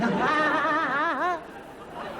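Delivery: loud, high put-on laugh; gleeful laughter. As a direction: laugh like a delighted child. Child delighted by dialing tone.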